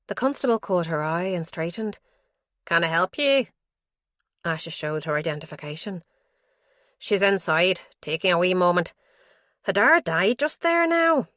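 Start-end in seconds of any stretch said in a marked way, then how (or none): 2.6-3.5 put-on Donegal accent. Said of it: "The Donegal accent was harsh". 7.0-11.3 a hint of a harsh Donegal accent, louder. Constable outside the door tells the detective the news, in a light Donegal accent. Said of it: "The Donegal accent was harsh".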